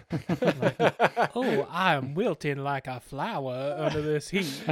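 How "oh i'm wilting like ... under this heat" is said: Tennessee Williams. Acted. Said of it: "like, Tennessee Williams".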